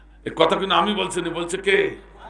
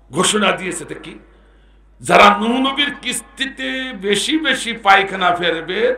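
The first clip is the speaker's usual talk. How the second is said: normal talking voice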